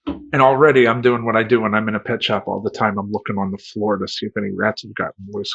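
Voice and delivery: ordinary talk, nothing marked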